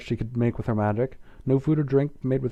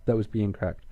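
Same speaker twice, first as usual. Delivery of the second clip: usual speaking voice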